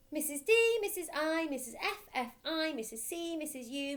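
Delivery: put-on small boy reciting. Boy reciting the spelling rhyme, high and proud. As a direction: chant it proudly like a schoolboy.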